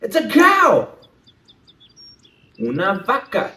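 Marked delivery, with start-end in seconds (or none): none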